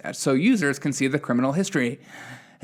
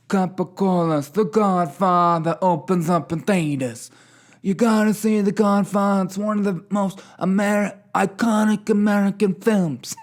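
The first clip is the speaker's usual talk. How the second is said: hammed-up mock Italian-American accent, higher; lightly put-on Godfather-style Italian accent; bad Italian accent; 6 semitones higher than usual. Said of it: "Sorry, that's such a bad accent".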